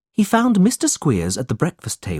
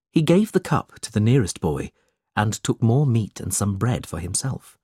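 ordinary delivery

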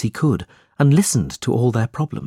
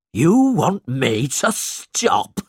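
higher, slow scornful sneer; mocking question; 6 semitones higher, slower than usual. A narrator's reading. Squeers mocks Nicholas for defying him, slow and scornful. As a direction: draw it out with disbelief. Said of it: "Mister Squeers asked".

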